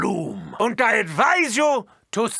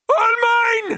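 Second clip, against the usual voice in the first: maniacal gloat; 11 semitones higher, 4 dB louder than usual. Acted. Gloating over his invention.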